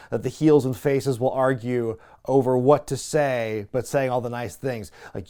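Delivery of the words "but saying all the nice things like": ordinary talk, nothing marked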